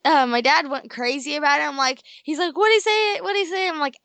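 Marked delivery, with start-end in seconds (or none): 2.6-3.7 higher-pitched, urgent repeated question; imitating excited dad. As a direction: re-enact an excited dad demanding to know. Acts out dad's urgent question.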